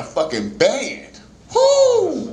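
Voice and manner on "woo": high loud woo; excited whoop. Retold or imitated. Comic cheering the church band with a high whoop. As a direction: whoop like the band just kicked in.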